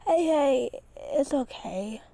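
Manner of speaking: gentle, slow reassurance; soothing. A narrator's reading. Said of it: "trying to cheer him up".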